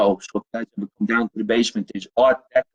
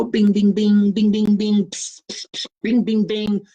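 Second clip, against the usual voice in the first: radiator bings; 8 semitones higher, slower than usual. Retold or imitated. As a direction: mimic the radiator clanging and hissing. Imitating radiator noises.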